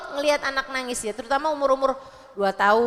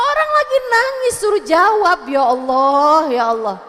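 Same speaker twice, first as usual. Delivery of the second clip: exasperated; 8 dB louder than usual. Real, not acted. Preacher despairing at mothers' questioning, exasperated. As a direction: scold the mothers in loud disbelief.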